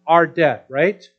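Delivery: loud, high fervent preaching; preaching at full force. Acted. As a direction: drive the point home to the congregation. Preaching the point.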